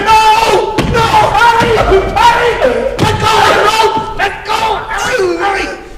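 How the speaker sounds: high, panicked shouting; urgent, high-pitched pleading shouts; frantic calling out. Acted. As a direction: yell in alarm to stop him. Frantic struggle over the rope.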